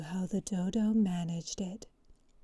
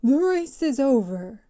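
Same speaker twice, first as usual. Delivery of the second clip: loud, higher sudden announcement; calling out; 4 semitones higher, 10 dB louder than usual. Acted. Dodo ending the race for all the runners, loud call. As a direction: call it out across the whole field. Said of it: "the Dodo suddenly called out".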